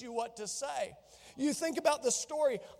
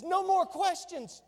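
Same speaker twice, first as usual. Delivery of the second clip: higher, slow, final command; emphatic God; 7 semitones higher, slower than usual. Acted. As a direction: shut the questions down for good. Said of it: "the Lord's emphatic, you know, determination".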